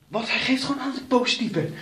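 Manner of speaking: high, loud and fast disbelief; shocked outburst. Real, not acted. Player told he's positive, blurting in disbelief.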